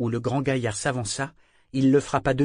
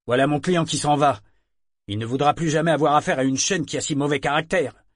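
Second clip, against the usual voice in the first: louder, fast, annoyed complaint; irritated broker; 4 semitones higher, 7 dB louder, faster than usual. A narrator's reading. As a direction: rattle off the grievance. Broker complains, client leaving.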